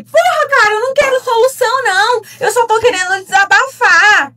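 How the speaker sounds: high, loud acted protest; exasperated venting. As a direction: act out a fed-up plea to a partner. Voices own exasperated plea to husband.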